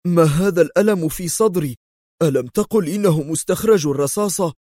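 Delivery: a patient's irritated cry of complaint; indignant outburst. A narrator's reading. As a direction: protest the pain, annoyed and aggrieved. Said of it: "وهتف في سخط".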